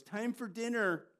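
high, loud call to dinner; mom calling out. Retold or imitated. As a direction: call the kids in from the next room. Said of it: "his mom goes".

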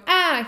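loud high "Ah" shout; short, loud cry; mock yell. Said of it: "if they're going".

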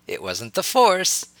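slow teasing grin in the voice; grinning. A narrator's reading. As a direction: tease them with a grin. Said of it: "said Harry with a grin".

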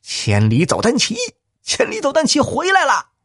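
high-pitched astonished exclamation, rising on the repeat; startled cry. A narrator's reading. Shen Daqiang announces the treasure is back, crying out amazed. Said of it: "沈大强惊叫啊".

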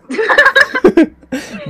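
high, loud burst of laughter. Real, not acted. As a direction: let out a big tipsy laugh. Big drunken laugh.

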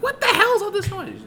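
high mock mom voice, laughing through it; imitating his mom. Mom hearing cat noise, high mock-annoyed voice. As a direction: squeak out the mom's annoyed question. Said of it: "So my mom was like".

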